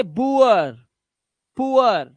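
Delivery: बुअर, पुअर with gliding 'oo-uh'; 'ua' sound. Shown as a demonstration. Teacher reading double-o plus r examples, 'ua' sound. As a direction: glide from 'oo' to 'uh' in पुअर. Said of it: "वो उआ हो जाता है".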